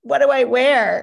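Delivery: squealed high and loud in a panicked thrill; excited squeal. Retold or imitated. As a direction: shriek it like the big news just landed. Retells her reaction to the call.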